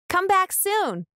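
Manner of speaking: plain reading voice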